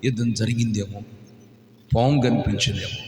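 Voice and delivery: soft, ominous sermon voice; hushed preaching. Acted. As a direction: drop low and soft, building suspense. Hushed dramatic listing.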